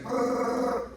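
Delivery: high buzzing brrr sound; hamster running noise. Retold or imitated. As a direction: imitate a hamster spinning.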